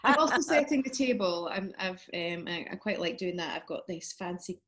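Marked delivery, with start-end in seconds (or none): 0.0-1.5 laughing through the words. Real, not acted. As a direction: giggle it out. Laughing at her own chore.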